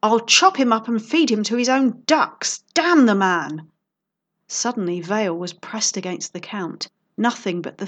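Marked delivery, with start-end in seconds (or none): 0.0-3.7 loud, raging threat; furious. Acted. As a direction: rage out the threat. Count rages at the poisoner.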